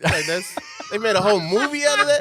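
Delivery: loud, fast excited rant. Real, not acted. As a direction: gush about it at full volume. Excited rant about ad lib.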